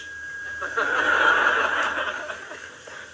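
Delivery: breathy, airy chuckle at her own joke; real laugh. Real, not acted. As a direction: warm unvoiced laugh.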